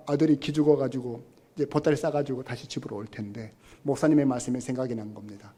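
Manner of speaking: usual speaking voice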